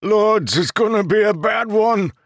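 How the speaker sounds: high, loud warning cry; alarmed shout. Acted. Soldier Gavin warning the bridge crew, loud and alarmed. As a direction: shout the dread down the line. Scout horn, battle coming.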